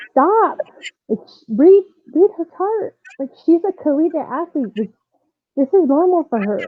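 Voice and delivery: imitating an urgent, high-pitched halt; urgent intervention. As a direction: cut in urgently to halt a mistake. Nurse halting staff with paddles.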